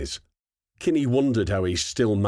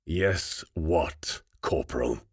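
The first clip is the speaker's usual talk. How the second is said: menacing sergeant; 3 semitones lower, 3 dB quieter, slower than usual. Acted. Sergeant corrects her menacingly.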